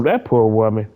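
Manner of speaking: normal talking voice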